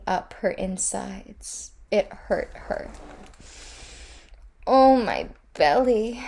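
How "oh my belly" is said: higher sighing lament, a bit louder; a pained sigh over her belly. A narrator's reading. The Queen of Death sighing over her aching belly. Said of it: "she said with a sigh".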